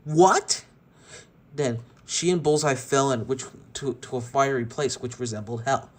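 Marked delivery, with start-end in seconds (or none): 0.0-0.7 loud, higher startled cry; shocked shout. Acted. Jessie shouting as the portal opens, shocked. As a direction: bark it out in sudden shock. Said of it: "Jessie looked and shouted".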